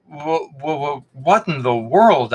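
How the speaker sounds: halting stutter, then slow astonishment; stammering. A narrator's reading. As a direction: trip over the first sound in shock. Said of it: "I stammered".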